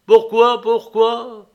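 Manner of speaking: high, loud, urgent questioning; eager interruption. A narrator's reading. Nicolas interrupts his brother's confession, urgently. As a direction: cut in, pressing for the answer.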